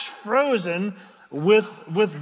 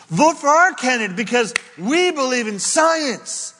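mock campaign slogan; 7 semitones higher, 4 dB louder than usual. Mocking political campaign slogan.

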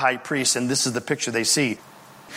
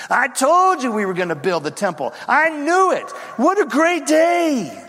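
triumphant exclaiming; 9 semitones higher than usual. Acted. Temple supporters gloating at the ribbon cutting, triumphant exclaiming. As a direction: proclaim it like a long-awaited victory. Builders celebrating the new temple.

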